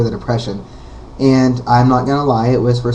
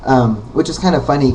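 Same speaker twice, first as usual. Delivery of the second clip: ordinary talk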